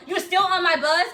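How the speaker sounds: loud, high, drawn-out challenge; incredulous sergeant yell. Retold or imitated. Sergeant at zero finds recruit still aboard, yelling. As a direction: imitate a sergeant hollering in disbelief.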